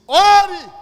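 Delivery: sudden, very loud high shout; shouted command. Retold or imitated. God orders the salesman to pray, shouting. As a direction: thunder out one drawn word as an order.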